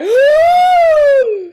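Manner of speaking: loud, high sharp gasp of joy; thrilled gasp. Retold or imitated. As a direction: suck in air as if hit by great news. Hearing she got the part.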